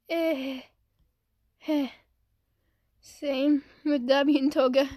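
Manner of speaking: ordinary talk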